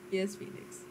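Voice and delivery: normal talking voice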